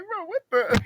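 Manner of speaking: high-pitched mock disbelief; high, trailing bewilderment; bewildered resident. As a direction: squeak it out in baffled shock. Bewildered resident whose house is hit.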